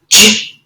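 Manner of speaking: "j-" as a short "juh"; just the opening sound "j-"; isolated phoneme. Shown as a demonstration. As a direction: sound out "j-", not "jay". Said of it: "substituting phonemes".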